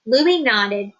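ordinary delivery, nothing marked

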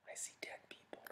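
breathy unvoiced whisper, barely audible; hushed spooky whisper; whispered movie quote. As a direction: whisper it like a spooky film line.

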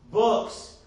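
Books hit loud and high; stress on Books. Shown as a demonstration. Preacher stressing Books to his congregation, loud. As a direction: lean hard on Books, the plural.

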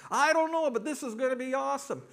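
loud, very high glee; hammed-up dopey excitement; thrilled ex-husband. Ex-husband answers his ex-wife in heaven, thrilled.

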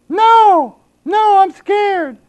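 high, slow falsetto whimper of fake fright; mock scared. Preacher playing the coward who won't chase the cougar, high mock fright. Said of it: "Are you gonna go after it".

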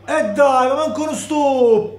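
frustrated shout. Real, not acted. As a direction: complain loudly. Frustrated at poor control.

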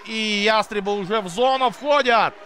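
ordinary talk

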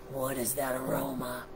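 low, hushed wondering; intrigued monster. A narrator's reading. Monster sniffing the aroma, low and quiet. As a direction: drop low and soft, following a smell.

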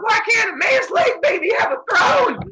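high, loud tantrum wail; whine-shout like a furious child; hissy fit. Retold or imitated. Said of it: "throwing what scientists call a hissy fit".

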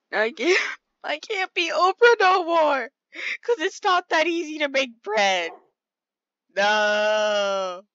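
loud high-pitched rant; exasperated outburst, pitch jumping up. Real, not acted. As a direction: blow up in loud frustration over the bread. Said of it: "Okay, I'm done ranting".

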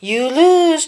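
very high, loud cyborg yell; Grievous yelling. A narrator's reading. Grievous yells as he escapes, loud high triumph. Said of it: "General Grievous yelled".

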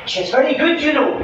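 put-on high, eager old woman's voice; old lady gossip. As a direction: lift into a chatty old dear. Old lady at the fortune tent.

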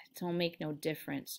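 subdued, weary dismissal; resigned. Acted. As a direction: let it drop, quietly resigned. Resigned George answers after a sigh.

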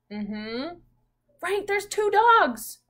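ordinary talk

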